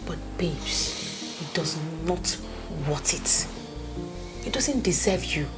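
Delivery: whispered, voice dropping low; hushed. Acted. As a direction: lean in and whisper it. Friend drops voice to confide.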